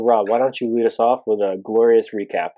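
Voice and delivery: usual speaking voice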